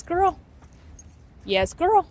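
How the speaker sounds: high encouraging praise for the dog. Real, not acted. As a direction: lift your voice to cheer a nervous dog.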